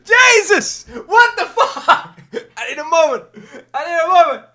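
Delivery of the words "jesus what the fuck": high, loud, stunned outburst; real, loud disbelief; excited shout. Real, not acted. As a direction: blurt it out in shock. Shocked, laughing at the verse.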